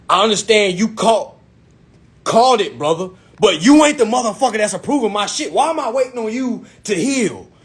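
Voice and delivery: high, impatient confrontation; inconsiderate. Retold or imitated. Impatient client confronting his sick agent, inconsiderate. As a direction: retell laying into him with no sympathy. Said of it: "I started getting inconsiderate".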